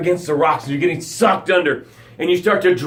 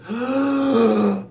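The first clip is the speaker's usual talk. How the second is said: acted gasp; 6 semitones higher than usual. Taking breaths after near drowning.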